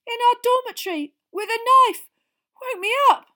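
ordinary delivery, nothing marked

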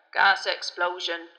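loud, slow grunt of an answer; grunted reply. A narrator's reading. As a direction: grunt it out as the driver. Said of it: "grunted Ernie".

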